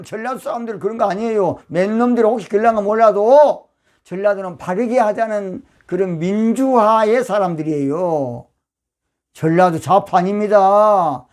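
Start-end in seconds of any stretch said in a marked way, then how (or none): none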